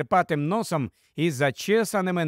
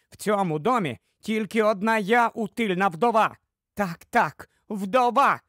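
higher-pitched scolding wife; sharp-tongued wife, indignant; 4 semitones higher than usual. Acted. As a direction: berate the husband with wounded indignation. (різка обурена дружина)

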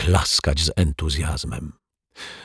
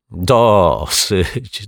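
louder, amused exclamation; laughing; 4 semitones higher, 5 dB louder than usual. A narrator's reading. Detective laughing off the fortune teller's prophecy. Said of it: "detektyw Pozytywka się roześmiał".

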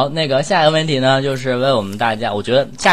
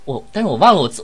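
ordinary delivery

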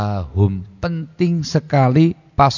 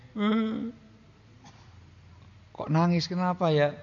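high, soft puzzled musing; puzzled husband; 8 semitones higher, 8 dB quieter than usual. Acted. Husband softly wondering why his wife cries, high.

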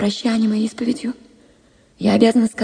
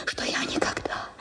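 much softer, whispered; whispered vow; 15 dB quieter than usual. Acted. Vera swearing fidelity to him, whispered. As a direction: drop to a whisper as if confiding. Vera vows never to love another.

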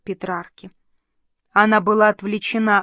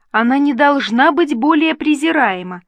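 higher and louder, a fervent protest; fervent exclamation; 5 semitones higher, 5 dB louder than usual. A narrator's reading. Catherine defends Elizabeth to her, in a higher, louder fervent cry. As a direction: declare it with passion. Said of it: "воскликнула Катерина".

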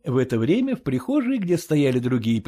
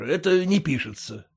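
plain reading voice

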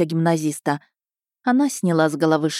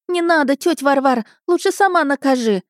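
put-on high, pleading boyish squeal; 9 semitones higher, 3 dB louder than usual. A narrator's reading. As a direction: beg auntie for mercy, pitched way up. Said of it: "верещал тот". (визгливая мальчишеская мольба)

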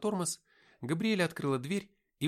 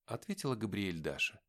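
usual speaking voice

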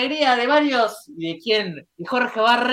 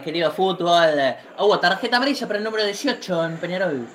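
usual speaking voice

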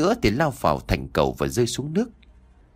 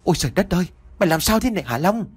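put-on startled old father, high and fast; gasping father discovering his son hurt; shocked cry; 6 semitones higher, much faster than usual. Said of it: "kêu lên thất thanh".

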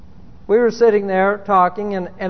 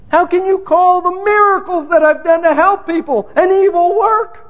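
escalating outrage; 8 semitones higher than usual. Retold or imitated. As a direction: climb into outraged disbelief. Same question repeated, escalating.

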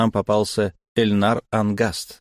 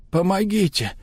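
higher hoarse cry for help; desperate shout; 10 semitones higher than usual. Acted. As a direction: call out, straining higher. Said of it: "крикнул я им". (отчаянный крик)

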